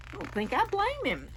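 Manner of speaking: ordinary delivery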